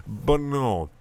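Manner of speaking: ordinary delivery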